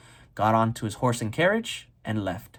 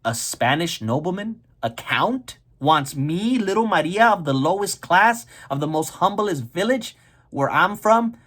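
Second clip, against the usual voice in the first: higher-pitched mock disbelief, building; shocked; 6 semitones higher, 4 dB louder than usual. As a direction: act stunned, rising into incredulous questions. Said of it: "Maria was literally shocked. She goes".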